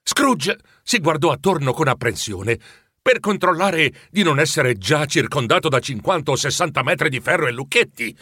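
ordinary delivery, nothing marked

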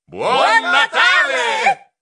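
the crowd answering the driver's greeting; crowd in chorus. Acted. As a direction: call it out together like a happy crowd. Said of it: "rispondemmo tutti in coro".

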